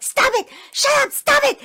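very high, loud mock grandma yell; angry yelling. Grandma silences child while dragging her out, angry yelling. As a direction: shout the child down, exasperated.

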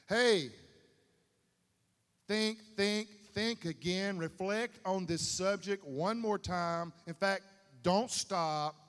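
loud, urgent preacher's call, slowing at the end; loud fervent sermon voice; fervent preaching. Acted. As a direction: drive the command home to the congregation. Preaching at full force.

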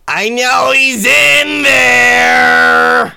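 high, drawn-out full shout; yelling from outside. Acted. Stranger outside banging on a car, furious yell. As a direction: holler it slowly at full volume. Unseen person yells from outside.

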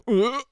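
high, loud retching "ugh"; imitating a sudden gag reflex; mock gag. Chewing baby-birded bread at the bar, mock gag. Retold moment of nearly gagging.

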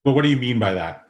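usual speaking voice

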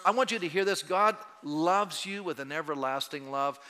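ordinary talk, nothing marked

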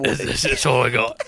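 horrible put-on British accent; bad British accent. Co-host asked for a British accent, doing it badly. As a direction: do a rough Jason Statham-style British. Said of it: "do a British accent".

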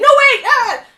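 a hammed-up alarmed yell; mock panic shout. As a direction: pretend the danger is real again. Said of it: "Just kidding".

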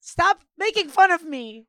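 mock hurt friend. Continuing the left-out friend bit.

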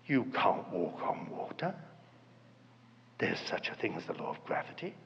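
very soft, low murmur; quiet doubt. Acted. Inner voice answering when we want evidence, hushed doubting.

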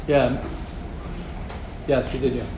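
ordinary delivery

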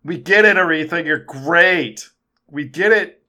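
loud sarcastic heckling; exasperated heckle. Real, not acted. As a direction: shout down the show-off. Heckling the movie star.

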